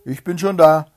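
loud, high call. A narrator's reading. Hedgehog greeting the hare at the top, loud call. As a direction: call it out to the arriving runner. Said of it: "rief ihm der Zwienägel entgegen".

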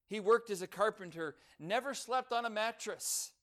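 full-voiced preacher building the point; preaching. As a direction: proclaim it from the pulpit with force.

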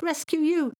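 very high incredulous question; high-pitched scoffing child; shocked squeal. Acted. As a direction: squeal back in disbelief. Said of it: "squealed Thomas, quite shocked".

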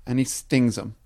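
ordinary delivery

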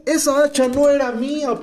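high, mildly wailed protest; mock cry out. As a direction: complain up high without really shouting. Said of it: "Y gritó, dice".

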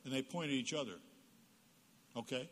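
ordinary delivery

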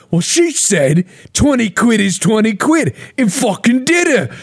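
imitating Chippy. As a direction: squeak the punchline like a cartoon lad. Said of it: "And he goes".

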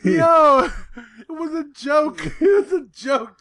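protesting through laughter; laughing. Real, not acted. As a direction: squeal it out between laughs. Laughing defense.

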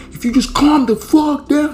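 high, loud mock yell. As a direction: shout at her to calm down. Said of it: "He's yelling at her".

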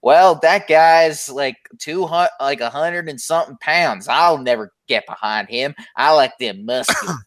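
put-on Southern old-time wrestling fan. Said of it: "That's how they talked".

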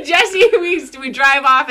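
usual speaking voice

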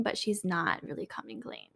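breathy aside, voice dropped to a whisper; conspiratorial whisper. Real, not acted. Telling her friend the confession was fake, in a whisper. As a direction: let it slip out under your breath.

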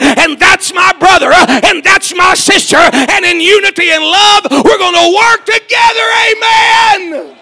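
loud, rising preacher's cry, building to a shout; full-force preaching. Acted. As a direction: preach it out to the whole church, climbing. Preaching climax to the congregation.

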